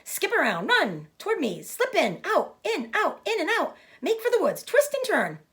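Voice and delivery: very high, climbing goose voice; a goose coaching in a frenzy; goose giving orders. Acted. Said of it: "cried the goose".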